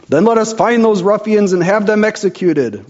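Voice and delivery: a lord demanding the ruffians be executed; roar. Acted. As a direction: bellow for vengeance. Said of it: "roared Lord Grizzleneck".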